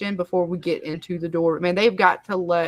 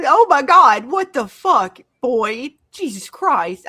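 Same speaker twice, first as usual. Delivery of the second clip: higher, much louder exasperated cry; real outburst; 5 semitones higher, 7 dB louder, slower than usual. Real, not acted. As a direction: genuine disbelief, let it burst out. Reacting to the scene.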